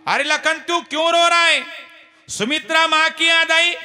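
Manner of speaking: high, loud, shouted worry; anxious calling. Acted. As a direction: demand to know why he cries. Rama questions weeping Lakhan.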